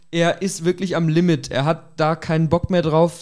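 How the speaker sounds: ordinary talk, nothing marked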